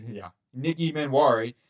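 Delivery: usual speaking voice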